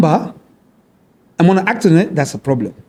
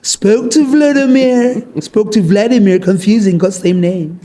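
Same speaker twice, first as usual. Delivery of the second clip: higher, drawn-out Trump impression; 5 semitones higher than usual. Podcast guest mimics a leader on Vladimir, Trump impression. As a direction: stretch the words like a mock Trump. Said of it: "How many people can he impersonate?".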